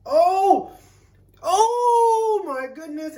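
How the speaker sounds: high, loud cry of surprise at the heat; spicy shock. Real, not acted. Tasting hot sauce on camera, a loud high yelp of shock. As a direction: yelp as the spice hits.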